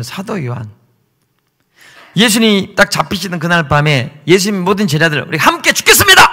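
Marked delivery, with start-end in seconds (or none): 5.3-6.2 loud vow. Acted. Disciples vowing loyalty.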